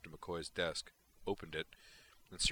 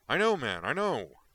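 louder, higher, fed-up protest; loud, exasperated I know; 7 semitones higher, 9 dB louder than usual. A narrator's reading. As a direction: wave off the lecture impatiently. Exasperated reply to scolding.

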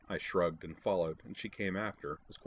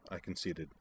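plain reading voice

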